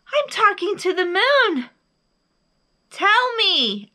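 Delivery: high, loud glee; eager high-pitched bear; very excited. A narrator's reading. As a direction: call out, thrilled. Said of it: "Bear got very excited".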